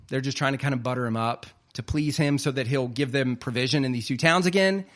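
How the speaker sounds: ordinary talk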